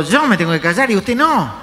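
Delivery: high, loud acted retort; mock indignation. Woman answering man who shushed her, loud mock indignation. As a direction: imitate someone affronted at being shushed.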